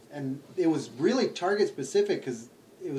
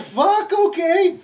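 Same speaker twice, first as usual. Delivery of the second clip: stunned delight; 12 semitones higher, 4 dB louder, much slower than usual. Retold or imitated. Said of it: "I'm like".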